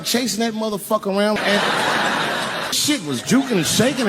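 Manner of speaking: ordinary delivery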